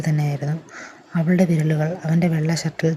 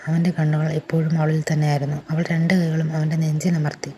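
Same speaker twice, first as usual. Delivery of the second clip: plain reading voice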